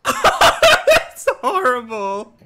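high, loud laugh drawing out the words; appalled laughter. Real, not acted. Player reacting to a horrible sight, appalled laughter.

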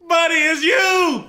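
loud, slow, amused callout; laughing shout. Real, not acted. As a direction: yell it through laughter. Busting the fake voice.

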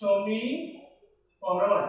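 অসমীকরণ stretched out very slowly; very slow term. Shown as a demonstration. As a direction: dwell on each part of অসমীকরণ. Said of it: "কথাটার মধ্য দিয়েই". (খুব ধীরে অসমীকরণ)

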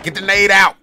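sharp, high urgent yell; grenade warning yell. Real, not acted. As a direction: yell it at your teammate, urgent. Urging teammate to throw grenade.

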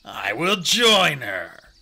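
loud, slow, drawn-out warrior voice; steady older-ally voice, deliberate; Whitestorm. A narrator's reading. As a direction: offer it slowly and solemnly. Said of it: "meowed Whitestorm".

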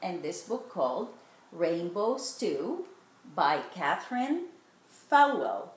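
storybook read-aloud voice; gentle picture book reading; reading to children. A narrator's reading. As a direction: read it like bedtime story time.

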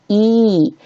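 E held long as ee; long vowel E. Shown as a demonstration. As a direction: draw out E in demonstration. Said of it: "它发长元音".

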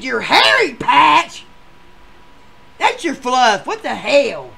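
high, loud, slow disbelieving echo; loud scoffing repeat; mocking yell. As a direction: throw her words back, loud. Ed mocking her words.

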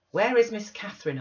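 stern demand. Acted. Nelly confronting Linton alone, stern demand. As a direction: demand it to frighten the boy. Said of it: "I demanded sternly".

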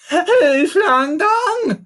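very high, loud monkey yell; accusing yell. A narrator's reading. Monkey confronting elephant and rhinoceros, accusing yell. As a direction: fling the accusation out at the top of your voice. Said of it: "Fatty yelled accusingly".

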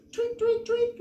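high, chirpy tweets; birdsong. Acted. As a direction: chirp them out like morning birds. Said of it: "went the birds".

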